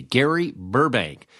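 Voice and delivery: usual speaking voice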